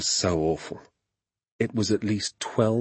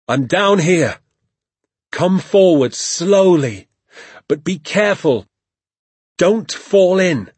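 loud, raised, drawn-out call; calling up a warning; 10 semitones higher, 8 dB louder than usual. A narrator's reading. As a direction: call slowly and carefully from down in a pit. Father calls warning from pit.

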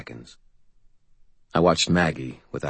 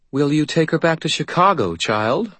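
higher, mocking fae voice; arch, teasing faerie queen; faerie godmother; 10 semitones higher than usual.

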